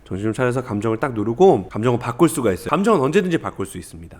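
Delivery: normal talking voice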